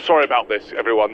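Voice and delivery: ordinary delivery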